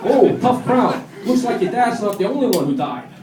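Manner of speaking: high, loud stand-up comic patter; hard-sell a bad joke; funeral stand-up. Gatecrasher doing cheesy stand-up at a funeral, high and loud. Heckling mourners.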